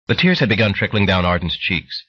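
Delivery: plain reading voice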